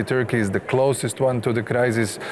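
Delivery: ordinary talk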